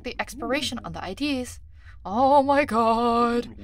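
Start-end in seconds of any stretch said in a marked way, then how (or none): 2.0-3.5 loud, long-drawn outcry; loud exclamation. Real, not acted. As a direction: stretch it out in loud dismay. Mock exasperation at long instructions.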